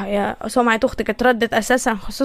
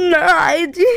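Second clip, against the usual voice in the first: tearful denial; 3 semitones higher, slower than usual. Acted. Denying she is crying.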